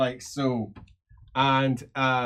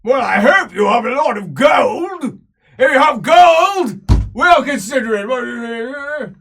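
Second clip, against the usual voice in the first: loud, high dwarf bellow slowing at the end; 11 semitones higher, 12 dB louder than usual. Acted. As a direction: boom it out like a greedy dwarf. Dwarf slayer haggling for gold.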